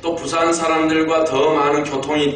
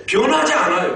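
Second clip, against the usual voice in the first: high, raised preaching emphasis; emphatic preaching; 6 semitones higher than usual. Real, not acted. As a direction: press it home with raised pitch. Emphatic statement, then repeated hushed. (높여 강조하는 설교조)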